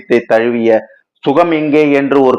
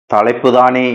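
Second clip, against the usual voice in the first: ordinary delivery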